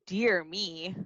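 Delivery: ordinary delivery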